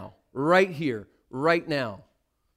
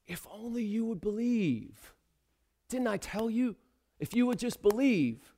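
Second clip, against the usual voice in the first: tender plea; 5 semitones higher, 7 dB quieter than usual. Acted. Jesus voiced, tender plea.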